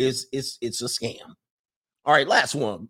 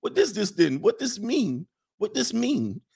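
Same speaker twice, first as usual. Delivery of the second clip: high-pitched mock foreign accent, somewhere between African and Lithuanian; muddled Lithuanian-African accent; 9 semitones higher than usual. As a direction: mimic the girlfriend's baffled questions in a high, garbled accent. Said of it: "is this an African accent or a Lithuanian accent?".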